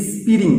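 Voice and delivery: স্প্রিং with an "i" added in front; extra vowels splitting up স্প্রিং; common mispronunciation. Retold or imitated. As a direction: say স্প্রিং as "is-piring". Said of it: "প্রথমে একটা".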